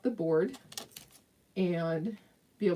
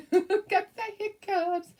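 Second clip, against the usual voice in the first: laughing through hiccups; 12 semitones higher, 5 dB louder, slower than usual. Real, not acted. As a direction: giggle it out, slow and high.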